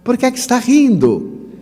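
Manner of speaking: high-pitched indignant call from afar; retold shout. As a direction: imitate an irritated man calling down the queue. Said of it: "gritou de lá".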